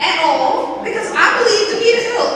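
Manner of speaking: building to a loud outburst; emphatic protest. Retold or imitated. Young woman protesting to her mother, loud and high. Retelling her own reluctance, excited.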